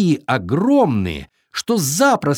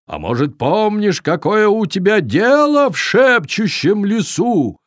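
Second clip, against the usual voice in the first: shouting; 7 semitones higher, 4 dB louder than usual. A narrator's reading. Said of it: "прокричал викинг".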